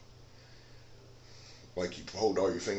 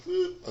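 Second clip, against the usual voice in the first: sudden yelped whoop, much higher; whoop of a swing; 4 dB louder than usual. Pretend. Showing viewers a defensive swing, sudden high whoop. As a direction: yelp it out like a blade swinging.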